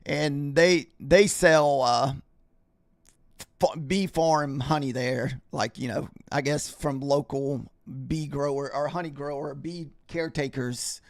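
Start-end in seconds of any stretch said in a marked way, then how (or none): none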